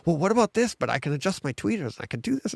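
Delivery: fussy newcomer. Retold or imitated. Mimics overwhelmed new home theater fans.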